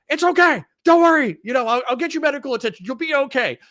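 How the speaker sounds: high-pitched, urgent reassuring cop; mock reassurance. Cop calming a scared suspect, high and insistent.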